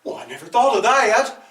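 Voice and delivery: usual speaking voice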